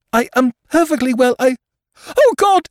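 high, loud, breaking into a scream; panicked denial. Acted. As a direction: insist shrilly, then shriek in terror. Woman panics, sees the apparition.

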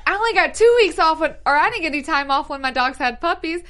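high, loud, aggrieved retort; mock indignant protest. Quoting Morgan about time off, mock indignant. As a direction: protest like it's deeply unfair.

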